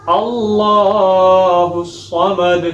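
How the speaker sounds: tajweed recitation; Quran recitation. Shown as a demonstration. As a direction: draw out Allah al Samad melodically. Recites second verse.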